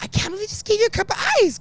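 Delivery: very high, fast disbelief; amazed. Retold or imitated. Getting free ice at the venue, high amazed. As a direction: rattle it off in giddy surprise.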